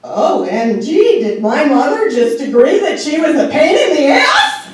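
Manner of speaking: laughing, loud and high; can't believe it, laughing; incredulous laugh through the words; laughing disbelief. Real, not acted.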